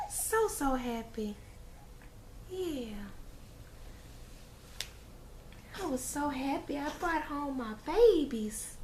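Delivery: high, slow, soft singsong. Real, not acted. Mom cooing while holding baby.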